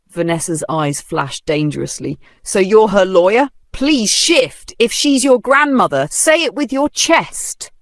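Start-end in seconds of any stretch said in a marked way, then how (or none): 2.4-7.7 loud challenge. A narrator's reading. Vanessa taunting the young man defending the old woman, loud. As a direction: dare him to say it.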